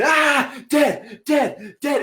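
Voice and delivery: shrieking like a four-year-old with a fake knife; kid screaming. Little boy screaming while hitting everyone. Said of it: "hitting people and screaming".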